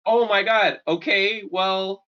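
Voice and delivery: high, outraged demand; retelling a childish outburst, pitched high; indignant kid. Retold or imitated. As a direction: act the bratty kid insisting they pay. Kid demanding a new game.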